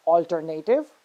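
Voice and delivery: "alternative" as al-ter-NAY-tiv, stress on NAY; common mispronunciation. Shown as a demonstration. Teacher reading words as most people say them, mispronounced "alternative" with stressed NAY. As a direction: say "alternative" with a full, stressed "native" ending. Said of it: "read these words the way normal people would read".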